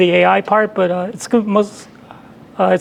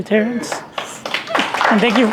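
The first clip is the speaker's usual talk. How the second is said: ordinary talk, nothing marked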